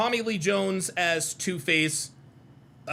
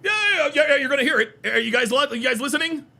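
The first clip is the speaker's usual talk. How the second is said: loud, high, urgent jeering; excited mockery; 5 semitones higher, 4 dB louder, faster than usual. Real, not acted. Streamer urging viewers to listen to the clip, excited mockery. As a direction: pounce on it, pressing the listeners.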